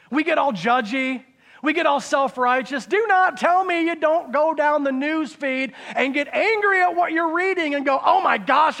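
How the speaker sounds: loud, high, impassioned preacher's cry; full-force preaching. Real, not acted. Preacher challenging the congregation about getting judgy, full-force preaching. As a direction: preach it loud, voice pitched right up.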